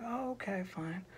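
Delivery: high, soft, resigned sigh of agreement; sad little troll. Acted. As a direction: give in weakly and quietly. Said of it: "that sad little troll was like".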